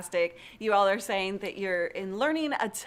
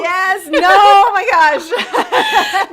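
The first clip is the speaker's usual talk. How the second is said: laughing cry; 12 semitones higher, 18 dB louder, much slower than usual. Real, not acted. Mock horror at media kit.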